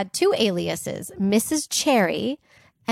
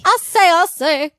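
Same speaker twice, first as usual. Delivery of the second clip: higher, louder rooster voice; Foghorn Leghorn; 8 semitones higher, 8 dB louder than usual. Retold or imitated. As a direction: bluster like the cartoon rooster. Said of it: "foghorn leghorn".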